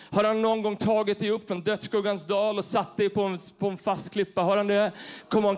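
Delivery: loud, rising preacher's call; fiery preaching. Real, not acted. Preacher to congregation, loud fiery preaching. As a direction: whip up the crowd with every question.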